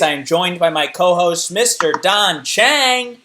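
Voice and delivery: loud, high announcer opening; game show host. Acted. Host welcomes viewers to the game show, big announcer voice. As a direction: belt out the big show intro.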